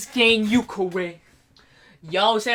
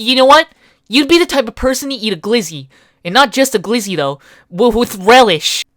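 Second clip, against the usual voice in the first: loud fast roast; 3 semitones higher, 7 dB louder, faster than usual. Acted.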